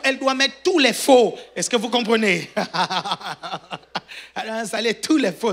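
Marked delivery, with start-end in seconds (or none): 2.5-4.3 quiet laughing at own joke; real laugh. Real, not acted. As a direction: chuckle softly.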